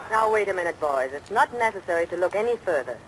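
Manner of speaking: ordinary delivery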